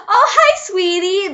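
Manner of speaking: high, loud, gushing greeting; mock sweet girlfriend. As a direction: gush like a fake lover. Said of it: "I'm like".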